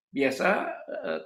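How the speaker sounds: ordinary talk, nothing marked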